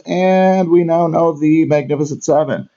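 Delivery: high, loud proclaiming voice; grand announcement. Acted. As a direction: announce it like a big reveal. Grand announcement at review end.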